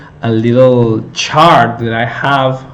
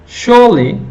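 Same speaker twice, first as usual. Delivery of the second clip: Surely said alone, high and loud on SURE; stressed onset demo; 10 semitones higher, 4 dB louder than usual. Shown as a demonstration. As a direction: jump up and punch SURE in Surely. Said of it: "sure will be my whole onset syllable".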